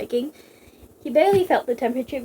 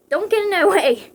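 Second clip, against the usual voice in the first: snarled; 6 semitones higher, 5 dB louder than usual. A narrator's reading. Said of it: "Snake snarled".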